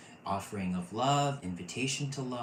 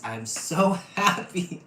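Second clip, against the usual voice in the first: higher, louder, slowed, with a laugh inside; laughing happily; 9 semitones higher, 9 dB louder, slower than usual. Real, not acted. Reader overjoyed with the group, laughing through the words. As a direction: let a laugh break the words. Joy at the readings.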